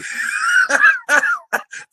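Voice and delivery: a high squealing laugh; high burst of laughter. Real, not acted. Cracking up at a viewer's joke, high laugh. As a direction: let out a high cackle at the joke.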